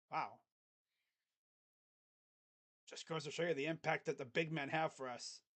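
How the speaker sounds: usual speaking voice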